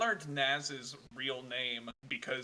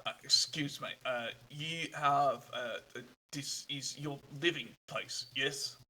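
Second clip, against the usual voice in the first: a hint of a halting German traveler asking directions; polite stranger searching for words; lightly voiced foreign traveler.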